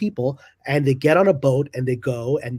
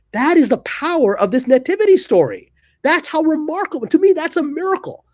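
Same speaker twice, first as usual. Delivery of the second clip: much higher, ringing with wonder; awed excitement; 9 semitones higher than usual. Real, not acted. Host marvels to guest at Ethiopia's lasting peace, awed and much higher. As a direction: lift the voice as if describing a miracle.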